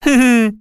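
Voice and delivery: high, short acted giggle; laugh. A narrator's reading. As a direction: giggle at the nonsense. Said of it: "засмеялась Нюшка". (высокий смешок)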